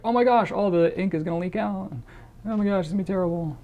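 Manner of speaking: a hint of mock alarm over leaking ink; mock panic. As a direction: play a fretting pen owner, half-joking. Imagined panicked pen owner.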